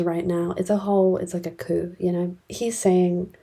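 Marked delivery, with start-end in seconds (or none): none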